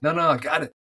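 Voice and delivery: put-on high, eager child's voice; mock kid protesting he's fine; kid insisting. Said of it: "He's like".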